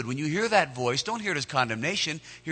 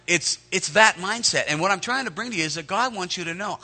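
usual speaking voice

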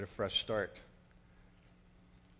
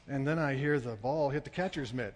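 plain reading voice

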